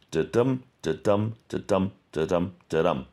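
"da-dum" with a light first beat and a stressed second beat; da-dum rhythm. Shown as a demonstration. Teacher demonstrating the iamb's beat to the class with a da-DUM rhythm. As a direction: tap out each iamb as da-DUM. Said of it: "two syllables".

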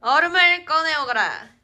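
mock royal command. Archaic royal command for ice.

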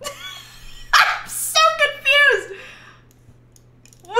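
high, slow confused laugh-talk. Real, not acted. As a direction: laugh the words out slowly.

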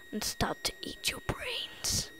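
high breathy ghost whisper; spooky whisper. Pretend. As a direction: whisper it like a scary secret. Spooky whisper about zombies.

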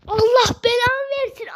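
real gamer rage; angry curse. Real, not acted. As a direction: spit the curse at the game. Cursing the game after miss.

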